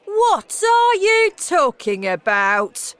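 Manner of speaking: high, slow outraged shout. A narrator's reading. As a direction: bellow it in disbelief, every word stretched. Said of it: "shouted Mister Jenkins".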